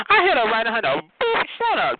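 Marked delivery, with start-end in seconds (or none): 1.2-2.0 high-pitched mock outrage; high, exasperated shut-down; sassy clapback. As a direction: squeal it as a dismissive put-down. Retold clapback in a quarrel.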